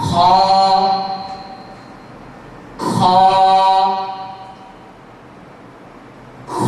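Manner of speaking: খ thick and heavy from the upper throat; a heavy throaty খ with open mouth; letter name Kha. Shown as a demonstration. Teacher models heavy খ for the class. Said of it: "আবার উচ্চারণ করছি".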